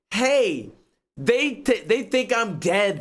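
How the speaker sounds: high, slow whiny mock of the game character; mocking Marvin's plea. Streamer mocking the game character who thinks he's dead, whiny mock. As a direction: pitch it up and drag it out, poking fun. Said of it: "After you just hear the conversation of".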